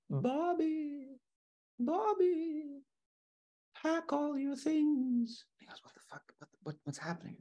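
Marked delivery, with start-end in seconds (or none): 0.1-5.4 very high, slow, eerie little voice; a small ghostly voice inside his head; tiny inner voice. Acted. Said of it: "little voice in the back of his head". 5.8-7.4 hushed, confused whisper; startled whisper. Acted. Man startled awake by voice.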